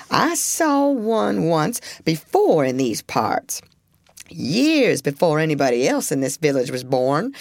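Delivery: put-on old beaver spinning a warning; grumbly old beaver. Granddaddy voiced in story.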